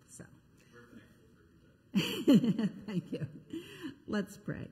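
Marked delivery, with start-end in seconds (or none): 1.9-2.8 quick embarrassed laugh; short laugh. Real, not acted. Pastor laughing at her own slip, short laugh. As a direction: laugh it off, a little sheepish.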